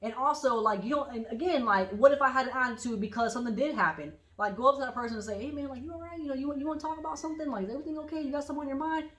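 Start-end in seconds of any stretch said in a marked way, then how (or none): 5.4-9.1 soft, higher, concerned voice; gentle check-in. Acted. Asking a moody friend what happened, softly concerned.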